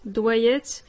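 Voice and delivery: ordinary delivery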